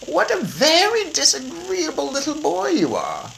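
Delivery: put-on high old lady voice, disapproving; disapproving grandmother. A narrator's reading. Grandmother scolding Bruno, high disapproving voice. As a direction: tut at the rude little boy.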